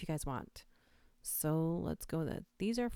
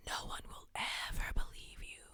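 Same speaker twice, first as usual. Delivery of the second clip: hushed creepy whisper into the mic; 6 dB quieter than usual. Pretend. Streamer reading a creepy chat line, whispered. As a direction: breathe it like a stalker in your ear. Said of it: "whispering something creepy".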